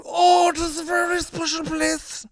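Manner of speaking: very high, loud, drawn-out caricature; mimicking Pierce Brosnan. Host mocking an actor's sudden switch to hometown accent, wailed caricature. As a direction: wail it slowly in an exaggerated put-on voice. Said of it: "he immediately goes".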